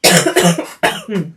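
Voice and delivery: a loud, hacking coughing fit; real coughing fit. Real, not acted. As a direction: cough hard through a bad cold. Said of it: "I'm really hating this cold".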